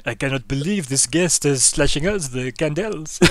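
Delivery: bad French accent, loud and bright like Lumiere; hammed-up French candle voice; mock French indignation at the guest. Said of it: "I like how you did the French accent".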